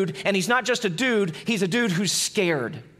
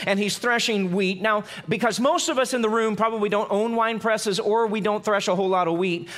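ordinary talk, nothing marked

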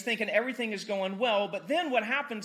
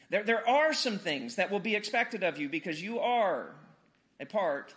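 normal talking voice

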